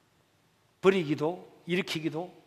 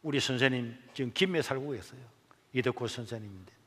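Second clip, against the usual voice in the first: ordinary talk, nothing marked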